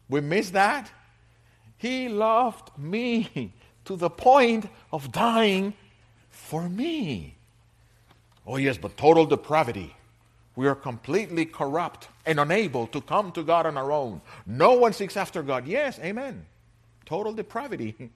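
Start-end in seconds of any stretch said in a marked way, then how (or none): 1.8-7.4 joyful exclamation. Real, not acted. Preacher exclaiming God's love. 8.5-18.1 loud, emphatic sermon voice, driving each point home; fiery preaching. Acted. Preaching at full force.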